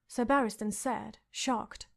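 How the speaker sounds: ordinary delivery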